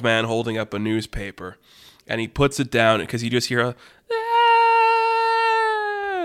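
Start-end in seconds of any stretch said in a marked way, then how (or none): 4.1-6.2 long, very high thin squeal; sustained "ah" of a tiny creature fleeing; small minion's scream. Acted. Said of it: "of, like, a small minion running across the street".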